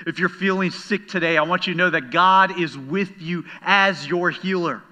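preacher climbing in pitch and volume; building sermon litany. Acted. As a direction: build the refrain toward the healer line.

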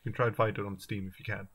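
ordinary talk

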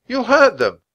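high, loud protest; crying out. A narrator's reading. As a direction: plead urgently for the birds. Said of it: "cried Annie".

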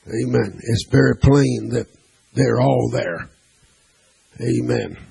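ordinary talk, nothing marked

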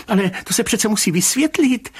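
high, flustered protest; flustered babbling. A narrator's reading. Rybka objecting to the commissioner's indifference, flustered high babble. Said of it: "zabreptal pan Rybka".

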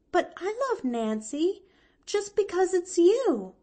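put-on high, eager little-girl voice; earnest young girl's high voice; earnest Pollyanna declaring. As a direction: declare it fondly, pitched high. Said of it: "declared Pollyanna".

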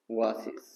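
ওয়াসিস with a clear w sound at the start; word said as a model. Shown as a demonstration. Teacher models ওয়াসিস to show its w start. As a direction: say ওয়াসিস, leading with a w glide. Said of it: "ওয়াসিস উচ্চারণে উ উচ্চারিত হয়েছে".

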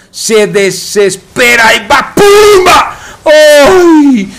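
erupting esports commentary; explosive play call. Real, not acted. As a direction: build into a high, shouted impact word. Commentator calls a sudden big hit.

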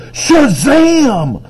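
high, drawn-out comic-book battle cry; yelled. A narrator's reading. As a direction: yell it out, stretching the word. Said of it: "Gladys yelled".

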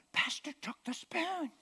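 wife insisting to her husband that the pastor took the spoon; insistent wife. Retold or imitated. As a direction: play the worked-up wife, sure of it.

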